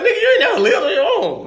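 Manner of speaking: mock incredulous jab. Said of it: "you're like".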